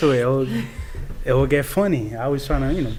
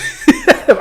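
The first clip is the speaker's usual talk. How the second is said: high, loud laugh; burst of laughter; 8 dB louder than usual. Real, not acted. Host cracking up over a real dating website, high loud laugh. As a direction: crack up loudly mid-sentence.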